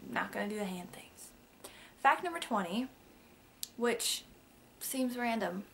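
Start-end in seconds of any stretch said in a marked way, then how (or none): 0.1-1.3 whispered aside. Real, not acted. Aside about her hand gestures.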